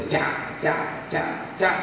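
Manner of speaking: soft, quick "tchá" slashes; whispered blade-swish sounds; machete slash sounds. Retold or imitated. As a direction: imitate machete strikes. Imitates machete blows.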